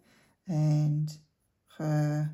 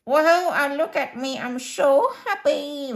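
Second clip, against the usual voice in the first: higher, cartoonish goat voice with a slow, happy "I'm so happy"; Lucky the cheeky goat; 8 semitones higher, 5 dB louder than usual. Drawn goat introduces herself to the viewers, high cheeky goat voice. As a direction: play a giddy little goat showing off. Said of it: "So what voice would she have?".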